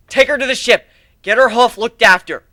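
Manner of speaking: loud, high command; urgent order. Acted.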